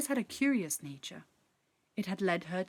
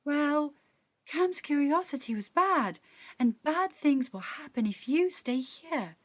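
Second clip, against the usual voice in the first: high, louder pleading sprite; 7 semitones higher, 4 dB louder than usual. A narrator's reading. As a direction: beg Tess to leave, voice raised. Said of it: "the sprite pleaded with Tess".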